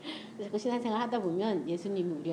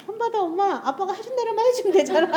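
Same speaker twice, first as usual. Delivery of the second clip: daughter's voice; 11 semitones higher, 6 dB louder than usual. Retold or imitated.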